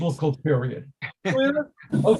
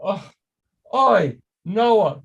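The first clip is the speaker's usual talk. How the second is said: "oi, Noah" sighed out Yiddish-style, louder; Yiddish lament; 4 dB louder, slower than usual. Shown as a demonstration. Rabbi to congregation, louder Yiddish "oi" lament. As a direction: groan "oi, Noah" like a weary Yiddish grandmother. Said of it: "Ach Noach".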